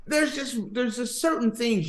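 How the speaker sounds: high-pitched comic exasperation; mock scolding. Mock scolding the man in the story.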